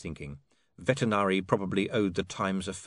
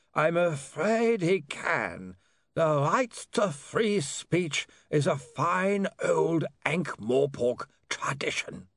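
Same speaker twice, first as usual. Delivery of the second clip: high, very slow lawyer's voice; pompous, reluctant legal pronouncement; lawyer Slant, regretful; 8 semitones higher, much slower than usual. Acted. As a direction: draw every word out, high and pained. Slant's held character voice.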